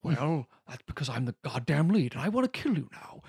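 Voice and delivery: mock the lead actor's showy period voice; Daniel Day-Lewis as Plainview. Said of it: "Daniel Day-Lewis".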